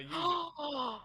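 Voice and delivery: slow, high, loud call; drawn-out shout. Acted. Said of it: "he's gonna shout back".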